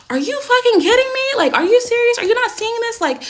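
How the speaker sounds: furious retold yell. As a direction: scream it in outrage at your father. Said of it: "I've lost my shit".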